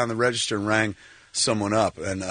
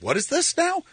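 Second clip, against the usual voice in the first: mother's puzzled question. Retold or imitated. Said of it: "my mom's like".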